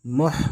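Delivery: मुह ending in a breathy h from the throat; throaty h. Shown as a demonstration. Teacher shows learners the big he in मुह, throaty h. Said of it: "है की आवाज़ हलक से होती है".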